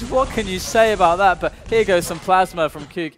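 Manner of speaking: very loud, high, hyped call; esports caster. Acted. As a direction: lose it over the perfect play. Excited live play-by-play.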